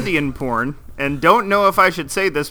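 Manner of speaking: ordinary talk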